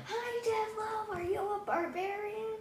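high-pitched naive character voice; childlike interruption. Acted. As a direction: pipe up innocently in a high voice. Player interrupts speech in character.

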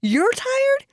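high, drawn-out disbelief; retold incredulous retort. Mother's incredulous retort to child.

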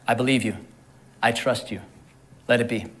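soft, low prayer, phrase by phrase; low and reverent, with pauses; quiet trust. Acted. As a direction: say it hushed, yielding to God. Quoted trusting reply to God.

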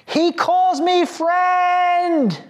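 very high, slow joyful shout; imitating Carolyn crying out. Girl in prayer room crying out her nickname, high slow shout. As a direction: cry it out in sudden joy. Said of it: "she cries out".

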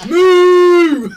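loud, high drawn-out cow moo. Pretend. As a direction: bellow it like a cow cutting in. Interrupting cow joke.

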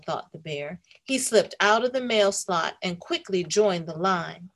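ordinary delivery

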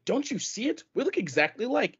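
high, pleading mock twin; British twin insisting, pitched well up. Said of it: "the British one is being like".

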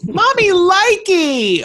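high, slow, loud squeal; excited squeal. Acted. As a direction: squeal it like a giddy mom. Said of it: "one of the lines I wrote".

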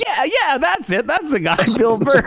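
high, laughing recall; lightly hammed-up excited recognition; mock eager Ray. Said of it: "And he's like".

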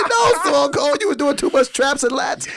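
high laughing mock voice, half shouted; cracking up while quoting the guard; laughing mock quote. Podcast host retells a guard's jab, laughing high.

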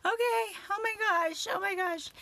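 high, loud panicked squeal; retelling the phone panic in a high loud squeal. Retold or imitated. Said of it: "I was like".

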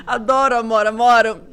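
loud, laughing greeting to someone absent; playful shout-out. Pretend. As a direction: call out a jokey kiss to her. Jokey shout-out to absent Amora.

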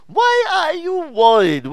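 high, drawn-out mock exclamation; exaggerated questioning. Preacher acting out Jesus questioning Martha, high and exaggerated.

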